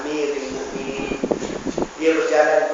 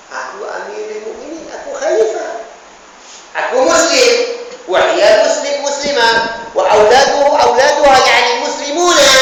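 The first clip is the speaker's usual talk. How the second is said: higher, building to loud; a lightly voiced caliph growing forceful; caliph's declaration; 8 semitones higher, 7 dB louder than usual. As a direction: Umar rising to proclaim his duty. Umar voiced in the story.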